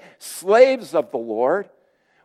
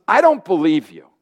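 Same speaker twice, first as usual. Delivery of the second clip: sceptical challenge; 3 semitones higher, 4 dB louder than usual. Pretend. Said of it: "Is the woman saying to Jesus".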